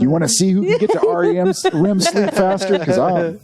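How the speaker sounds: talking through laughter; laughing. Real, not acted. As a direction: say it while laughing.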